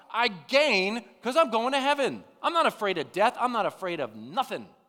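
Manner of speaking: loud, high, defiant declaration; preaching at full force. Real, not acted. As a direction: preach it boldly at the top of your voice.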